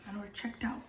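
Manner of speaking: whispering. Real, not acted. Whispering the end of the move.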